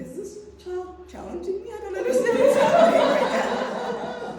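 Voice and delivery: high, slow, astonished inner voice, ending loud with laughter; disbelief. Retold or imitated. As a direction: voice total disbelief at a child. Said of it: "And I'm thinking".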